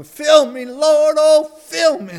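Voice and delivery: high, loud desperate cry to the Lord; pleading cry. Acted. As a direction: wail it out as he comes down the aisle. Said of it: "and he said".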